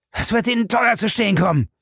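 very high, choked fury; near-sobbing rage. A narrator's reading. Krieger threatens Bellamy, almost sobbing with fury. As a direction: cry it out, almost in tears of rage. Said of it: "schluchzte beinahe vor Wut".

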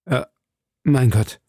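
mildly stammered, broken by a pause; falter, then get the words out; stammering. A narrator's reading. Felton answers the scornful prisoner, stammering. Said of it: "stammelte Felton".

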